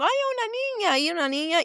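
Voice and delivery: high, delighted little cry on the first words; joyful surprise, retold; excited retold exclamation. Excited retold reaction to seeing newborn.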